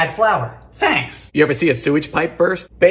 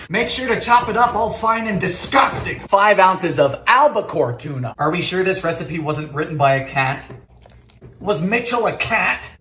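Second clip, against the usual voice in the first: ordinary delivery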